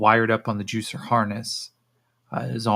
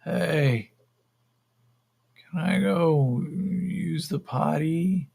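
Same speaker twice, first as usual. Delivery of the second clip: high, slow, dazed voice; drawn-out, higher-pitched stoner plea; timid request; 7 semitones higher, much slower than usual. Acted. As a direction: ask like a spaced-out kid, stretching the words. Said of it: "He goes".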